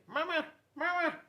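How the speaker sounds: very high, chirpy gosling calling Mama; baby goose voice. Acted. Goslings calling after grumpy bear Bruce, high and chirpy. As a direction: pipe it up like a tiny gosling calling its mother. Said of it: "they just kept saying".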